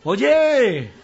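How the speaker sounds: high, loud taunt called from afar; bald man shouting. Acted. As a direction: yell it across the street to mock him. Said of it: "un calvo le grita".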